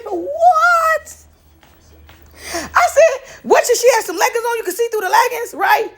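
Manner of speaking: high, laughing disbelief; shocked disbelief. Retold or imitated. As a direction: react stunned at the wild news. Shocked reaction to his story.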